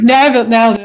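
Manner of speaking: loud mock celebration, repeated; triumphant boast. Mocking a one-visit gym-goer, loud triumphant boast. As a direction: crow it like a quick win.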